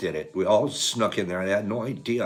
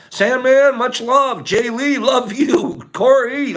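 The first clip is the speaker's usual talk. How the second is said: high, loud affectionate shout-outs; calling out farewells; 14 semitones higher, 8 dB louder, much slower than usual. Real, not acted. Streamer signing off to viewers by name, calling out. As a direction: holler each name with love.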